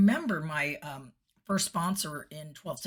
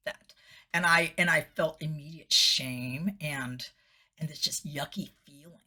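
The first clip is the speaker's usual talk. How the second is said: ordinary talk, nothing marked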